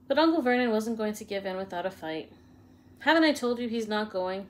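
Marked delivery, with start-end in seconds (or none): none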